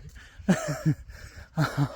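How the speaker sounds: laughing through pain after admitting he's close to tears; tearful laugh. Real, not acted. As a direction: laugh so you don't cry. Laughs while trying not to cry.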